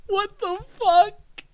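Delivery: high, strained panicked voice; panicked high cry. Acted. Scared host crying out at the silent kid. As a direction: squeal it out in terror.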